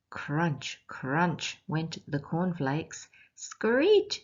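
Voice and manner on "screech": high, loud animal screech; sharp high possum screech. Acted. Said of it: "screech went the possum".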